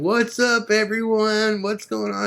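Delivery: high, bright-voiced rocker greeting everyone; excited rock star. [name] greeting the crew on entering, high and excited.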